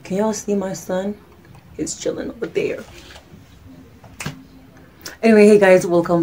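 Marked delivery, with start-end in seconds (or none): 1.8-2.8 whispered aside. Real, not acted. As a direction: whisper it off to the side. Aside about son.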